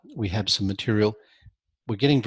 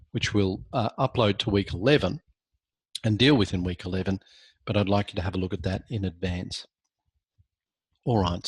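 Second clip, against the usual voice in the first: normal talking voice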